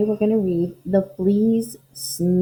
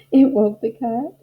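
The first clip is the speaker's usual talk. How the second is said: ordinary delivery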